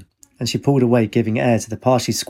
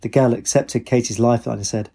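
ordinary delivery

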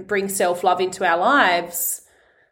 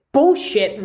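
sudden loud outburst on bullshit, higher; shouted swear; 6 semitones higher, 3 dB louder than usual. Real, not acted. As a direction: blurt bullshit out loud and high. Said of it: "I shouted".

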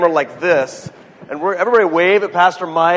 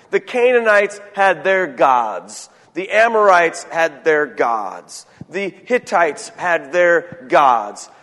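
slow list; slower than usual. Acted. Listing nations slowly.

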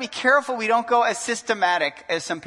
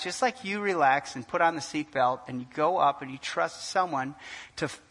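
normal talking voice

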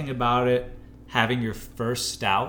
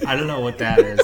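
ordinary talk, nothing marked

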